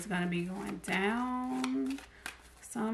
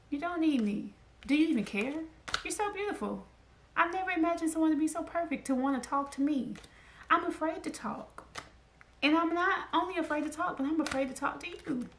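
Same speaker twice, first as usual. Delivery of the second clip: higher, pleading lover voice; lovesick shy admirer; 7 semitones higher than usual. Retold or imitated.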